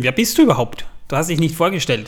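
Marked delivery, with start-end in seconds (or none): none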